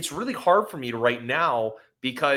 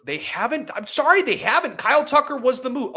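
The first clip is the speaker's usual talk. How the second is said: much higher, louder, insistent objection; heated outburst; 13 semitones higher, 4 dB louder than usual. Real, not acted. Cutting off his cohost to insist, in a heated higher outburst. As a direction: cut in hard to set the record straight.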